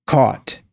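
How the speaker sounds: "caught" with rounded AW, falling; rounded, closing tune on "caught", AW not AH; caught, AW vowel. Shown as a demonstration. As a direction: say "caught" as kawt, lips rounded. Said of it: "repeating four or five times".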